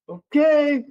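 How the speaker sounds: loud, high, drawn-out okay; imitated reluctant okay. Director gives in to the new coach, loudly dubious. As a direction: act out a director giving in with a big okay.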